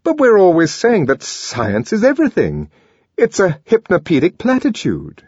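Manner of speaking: a hint of a puzzled young man; Helmholtz voice. A narrator's reading. Helmholtz objects, voiced higher.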